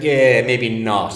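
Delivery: loud, drawn-out second thoughts; retold backtracking. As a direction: slowly take back the offer. Midwife retracts offer to dazed man.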